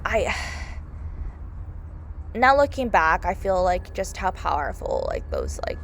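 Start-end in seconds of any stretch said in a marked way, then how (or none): 0.4-2.3 drawn-out breathy exhale; long sigh. Real, not acted. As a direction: sigh slowly, at a loss for words. Overcome looking back on film.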